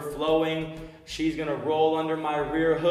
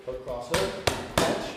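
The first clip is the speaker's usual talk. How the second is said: calling combos; slower than usual. Shown as a demonstration. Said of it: "you guys are gonna hear for how we put together".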